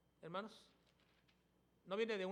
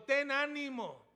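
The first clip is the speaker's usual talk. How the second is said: proclaimed quote; 6 semitones higher, 10 dB louder, slower than usual. Acted. Preacher voicing Jesus forgiving.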